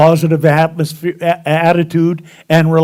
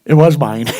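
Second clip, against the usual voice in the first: ordinary talk, nothing marked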